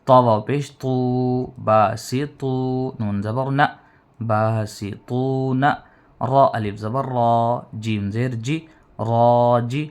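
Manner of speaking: teacher spelling aloud; slow syllable drill. Shown as a demonstration. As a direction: join the syllables slowly and clearly. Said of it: "ملائیں گے".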